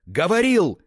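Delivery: high, very loud outburst; wounded scream. A narrator's reading. Betrayed friend throwing the word back, wounded scream. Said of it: "закричал, как раненый Фа Шэньхао".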